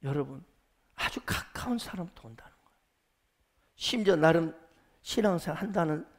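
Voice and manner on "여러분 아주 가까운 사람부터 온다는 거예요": normal talking voice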